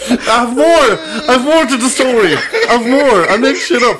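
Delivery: high-pitched plea through giggles; laughing protest. Real, not acted. Defending the story to teasing friends, laughing in protest. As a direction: insist on going on while cracking up.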